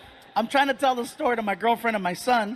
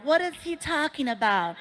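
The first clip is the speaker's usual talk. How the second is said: higher, bewildered mock voice; mock puzzled viewer; 6 semitones higher than usual. Confused viewers at home wondering, higher puzzled voice. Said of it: "watching right now wondering".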